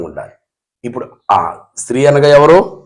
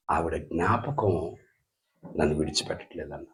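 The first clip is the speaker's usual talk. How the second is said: grieving, retold; 9 semitones lower, 17 dB quieter than usual. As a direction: let the grief pull the words down, much softer. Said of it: "పౌలు విపరీతంగా ఏడుస్తూ". (చాలా మెల్లగా, దుఃఖంతో)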